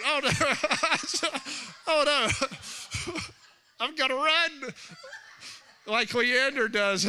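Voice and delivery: high, laughing through the words; giggling, can't hold it in. Real, not acted. A pastor laughing with his congregation.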